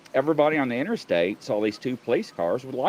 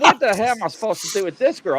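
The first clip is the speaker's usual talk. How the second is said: fast, higher, exasperated; fast exasperated cop stuck with the girl; 9 semitones higher, 4 dB louder, faster than usual. Retold or imitated. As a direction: rattle it off in disbelief. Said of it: "I'm like".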